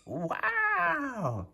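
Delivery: usual speaking voice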